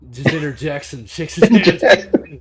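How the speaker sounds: can't stop laughing while describing it; laughing narration. Real, not acted. GM describes Latte cutting in, laughing out loud.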